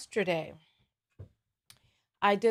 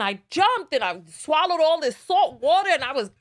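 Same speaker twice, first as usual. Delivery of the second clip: excited retelling; 7 semitones higher, 8 dB louder than usual. Retold or imitated. Retelling swallowing salt water.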